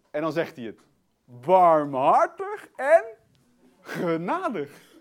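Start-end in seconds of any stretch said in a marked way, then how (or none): 1.2-4.9 very slow, drawn-out, emphatic words; relished proclamation. Real, not acted. Preacher reading out who God is, relished proclamation. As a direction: stretch each attribute out with delight. Declaims God's attributes with relish.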